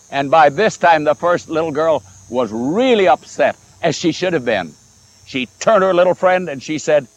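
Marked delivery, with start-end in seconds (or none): none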